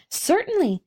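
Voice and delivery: high-pitched, cheered agreement; better spirits. A narrator's reading. Bengal Light agrees with the Roman Candle, in better spirits. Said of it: "now in better spirits".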